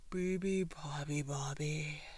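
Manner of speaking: drowsy sigh, sinking in pitch; sighing elephant. A narrator's reading. Elephant closing his eyes, sleepy sighing chant. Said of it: "sighed the elephant".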